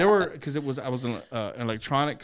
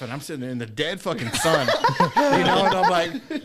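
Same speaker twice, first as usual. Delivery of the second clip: ordinary talk, nothing marked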